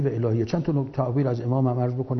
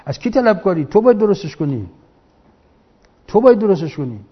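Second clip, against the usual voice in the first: louder, insisting you must fix it; forceful rebuke; 4 semitones higher, 6 dB louder than usual. Real, not acted. Preacher scolds the new generation, forceful and louder. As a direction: hammer home the duty, louder.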